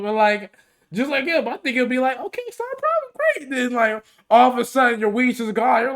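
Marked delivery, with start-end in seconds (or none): 2.2-3.5 much higher, sugary fake-nice; mock cheery staff. Mocking the too-nice airport agent in a much higher voice. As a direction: chirp it like a fake-friendly clerk.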